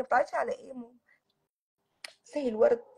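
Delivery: ordinary delivery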